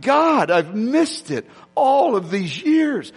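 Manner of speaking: put-on a touch of a weeping old man, slow; slow, mild mock of a man crying to God; understated tearful cry, drawn out; retold crying. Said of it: "he just crying".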